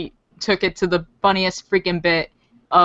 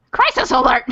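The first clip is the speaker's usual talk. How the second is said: raised-pitch mock alarm; playful, higher-pitched alert call; Garrett impression; 5 semitones higher, 3 dB louder than usual. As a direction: lightly imitate a background character's panicked catchphrase. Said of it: "Garrett, he's not mentioned by name".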